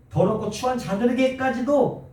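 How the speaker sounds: 더럽고 추한 자들에게까지도 said high; stress on 더럽고 추한. Shown as a demonstration. Preacher showing the stressed phrase to his church, high. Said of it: "강세가 있는 거죠".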